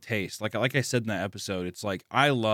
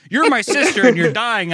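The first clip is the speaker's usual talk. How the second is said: high, loud mock-desperate exposition; melodramatic hero; 10 semitones higher, 8 dB louder than usual. Hero carrying dying sister, hammed-up desperation. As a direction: cry it out like overwrought anime dialogue.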